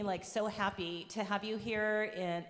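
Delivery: usual speaking voice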